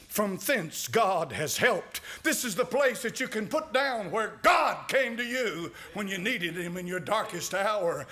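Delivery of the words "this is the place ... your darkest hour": ordinary talk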